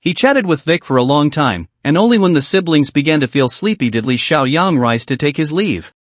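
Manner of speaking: ordinary delivery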